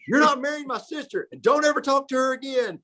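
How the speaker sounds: high squealing mock scolding. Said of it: "he used to squeal and yell at me".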